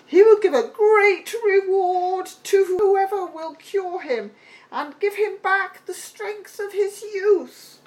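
high ringing herald's announcement; herald's cry. A narrator's reading. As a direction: call it out to the whole street.

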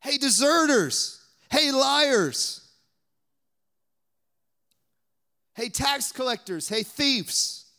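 high, loud mocking hails, easing off; mock accusing calls. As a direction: list the insults in a mock shout. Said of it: "He didn't say that".

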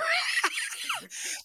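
shrieking high laugh; real high laugh. Real, not acted. Laughing at the prank.

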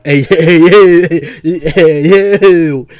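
loud laughing hey, hey over and over; laughing shouts to get a friend to stop; calling out loud. Real, not acted. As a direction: shout him down through a laugh.

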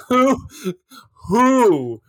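slow, drawn-out incredulous question; hammed-up bafflement with long pauses; exasperated disbelief. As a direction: stretch each "who" in mock outrage. Retelling own disbelief at bad dialogue.

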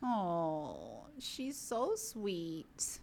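slow, soft and fond; drawn-out coo over a sweet pet. Real, not acted. Gushing over the dog in the cone, slow soft coo.

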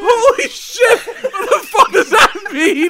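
loud, very high, words broken by laughter; helpless laughing disbelief. Real, not acted. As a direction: exclaim through a laughing fit. Still cracking up.

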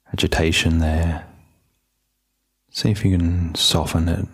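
low, even meditation voice with long pauses; calm, unhurried settling-in voice; guided meditation. Real, not acted. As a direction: gently guide listeners inward, leaving space between phrases.

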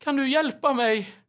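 high, slow, faint plea for help; a weak old woman calling for help; old lady's plea. Retold or imitated. The old neighbor calling for help from her bed.

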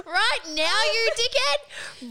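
cracking up in disbelief at the timing; laughing disbelief. Retold or imitated.

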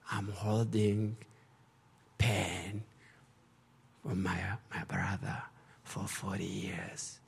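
slow, soft, aching admission; slow, very soft lament; heavy confession. Retold or imitated. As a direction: let it sink low and slow. Confessing pain to the group.

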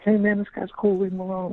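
high crowd voice. Retold or imitated. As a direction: voice the crowd defending him. Crowd voiced defending the comic.

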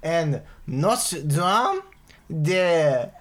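slow, loud, hammed-up French accent like a cartoon French cook; cartoon French chef accent, drawn out and loud; bad French accent. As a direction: ham it up like the French cook in Little Mermaid. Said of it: "you sound like the French cook".

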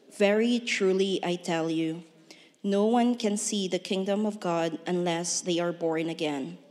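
usual speaking voice

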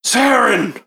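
high exclamation after a gasp; shocked recognition. Acted. Guard recognizes the dead woman, shocked.

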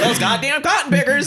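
high, squeaky caricature of a furious lady; mock outrage in a high cartoon voice; mocking an angry old lady. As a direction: sneer it out in a shrill put-on voice, laughing. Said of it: "And she was like".

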